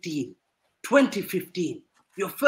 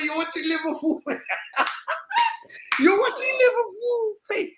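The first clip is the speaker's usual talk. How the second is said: mocking laugh; 10 semitones higher than usual. High mocking laugh into taunt.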